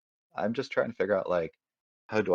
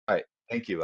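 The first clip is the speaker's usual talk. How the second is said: ordinary delivery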